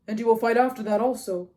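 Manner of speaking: loud, projected question; Agamemnon, pressing commander. A narrator's reading. Agamemnon pressing Achilles to keep fighting, loud. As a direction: press him in a big commanding voice.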